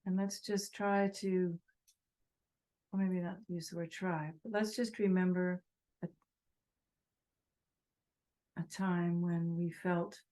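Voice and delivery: unhurried phrases with long silences; guided meditation. Real, not acted. Teacher asking meditators to think of all beings, guided meditation. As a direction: guide them gently, leaving space between phrases.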